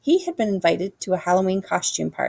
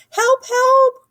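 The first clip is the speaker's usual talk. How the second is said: high, loud call for help; panicked hippo; 8 dB louder than usual. A narrator's reading. Hippo jumping into hamster's arms, high yelp. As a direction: yelp for rescue. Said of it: "cried the hippo".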